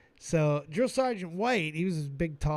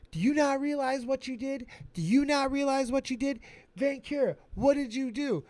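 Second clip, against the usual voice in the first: higher, insistent grilling of the recruit; drill sergeant interrogating; 8 semitones higher than usual. Retold or imitated.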